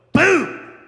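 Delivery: loud punchy boom; shouted boom; punch sound effect. Retold or imitated. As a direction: hit it like a punch landing. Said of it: "You go".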